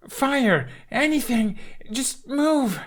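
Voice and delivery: high frantic calls; desperate pleading. Acted. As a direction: urge the dragon, panicking. Hiccup begging the dragon.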